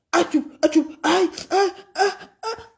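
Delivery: rising, softening faked cries; hammed-up whimpers; mock moans. Imitating sex cries.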